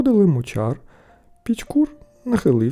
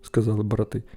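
ordinary delivery

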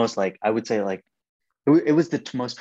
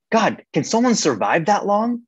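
much higher, louder, alarmed; stunned disbelief; 10 semitones higher, 3 dB louder than usual. Retold or imitated. Son reacting to a twenty-day fast, stunned and high. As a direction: voice jumps up in panic at the idea. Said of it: "I'm like".

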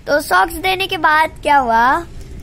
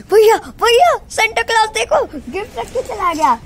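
excited little brother; 7 semitones higher than usual. Retold or imitated. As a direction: burst in calling bhaiya with joy. Brother retold running in.

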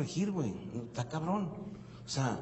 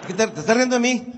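louder, higher mock angry dad; 5 semitones higher, 7 dB louder, faster than usual. Dad scolds son for laughing, hammed-up anger. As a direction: snap back at the mocking kid.

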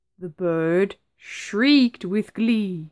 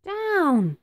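high called-out command; witch's cry; 10 semitones higher than usual. A narrator's reading. Witch calling the broomstick down to the ground. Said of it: "cried the witch".

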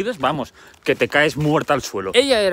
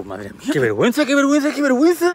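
high, fast, loud flustered babble; a flustered girl caught out by the goddess; panicked embarrassment; 12 semitones higher, 4 dB louder, faster than usual. Acted.

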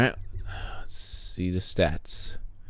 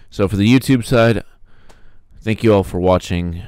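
outro voice; 2 semitones higher, 8 dB louder than usual. Acted. Channel outro sign-off.

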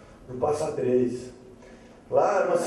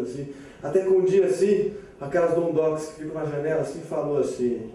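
ordinary delivery

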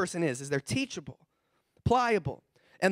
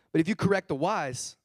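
ordinary delivery

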